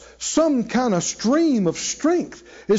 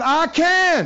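call it out; 7 semitones higher than usual. Shown as a demonstration. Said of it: "Say it out loud".

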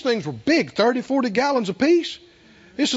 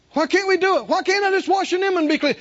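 higher, whining protest; indignant whine; 9 semitones higher than usual. Retold or imitated. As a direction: complain like a stubborn man. Preacher mimics Naaman's objection.

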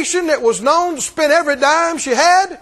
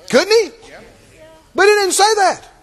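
rhetorical challenge; 8 semitones higher than usual. Acted.

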